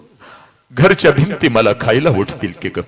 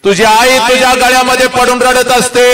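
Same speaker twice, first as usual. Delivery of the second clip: pitched higher, pleading; intensified lament; 9 semitones higher than usual. Acted. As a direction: push the grief up. Repeat, more intense. (अधिक उंच विलाप)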